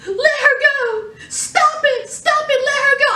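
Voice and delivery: high, panicked pleading; imitated frantic plea. Eve begs the assassin to release V, high panicked plea. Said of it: "she was like".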